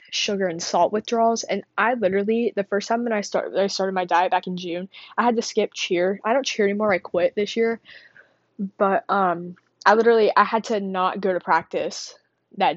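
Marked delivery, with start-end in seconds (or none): none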